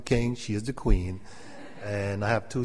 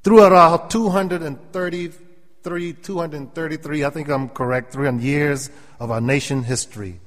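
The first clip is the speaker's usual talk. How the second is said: high, loud, slow preaching; 4 semitones higher, 6 dB louder than usual. Real, not acted. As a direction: lift it like a preacher in full flow. Preaching builds.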